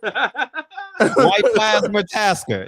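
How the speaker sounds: slow, drawn-out announcer voice; joking boxing introduction; mock ring announcer. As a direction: stretch the name like a fight announcer.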